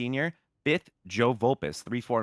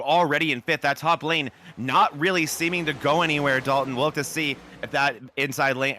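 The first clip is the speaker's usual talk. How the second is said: louder, rising race call as the green flag waves; live play-by-play; 4 semitones higher, 3 dB louder than usual. Acted. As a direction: call the start with building energy.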